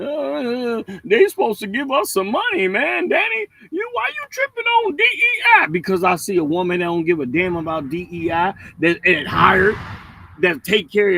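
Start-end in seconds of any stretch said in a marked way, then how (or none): 0.0-5.7 high-pitched mock crybaby whine; mocking whining. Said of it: "I see y'all whining and crying".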